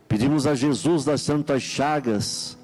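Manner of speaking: ordinary talk, nothing marked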